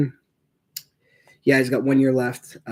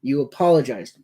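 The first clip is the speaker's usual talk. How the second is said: usual speaking voice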